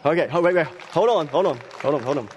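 pausing to catch breath; breathless, pleading for a moment. Real, not acted. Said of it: "I catch my breath here".

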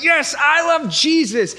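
high, loud, slow shout of joy; fired-up new believer. Retold or imitated. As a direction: burst out with new-convert zeal. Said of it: "You're like".

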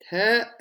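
"Thirsty" stretched loud and high in broken pulses; milk the first syllable of "Thirsty", loudly; drawn-out emphasis. Acted. Comic emphatic repeat of "thirsty.

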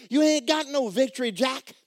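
loud, high scolding; scolding rebuke. Acted. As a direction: rebuke the faithful for shouting while sick. Scolding shouting believers.

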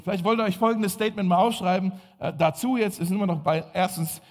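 normal talking voice

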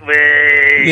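high drawn-out "whee" like feedback; imitated feedback squeal. Caller warns son about radio feedback, high imitated squeal.

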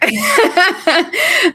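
a high burst of laughter; laughing at how good her friend sounded; high delighted laugh. Real, not acted. Amazed at friend's speaking.

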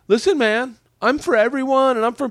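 put-on high, pious voice; publicly virtuous type. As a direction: imitate someone declaring their open-mindedness. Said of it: "say things publicly that would be like".

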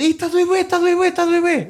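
high, loud mock whine of pain; whiny little brother. Older brother imitates his little brother complaining at the razor, high, loud whining. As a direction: whimper it like a crybaby kid, way up high. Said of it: "E ele".

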